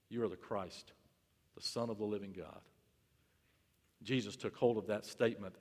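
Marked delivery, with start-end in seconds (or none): 0.1-2.6 soft and lower, reverent; hushed confession. Acted. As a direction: breathe the confession quietly.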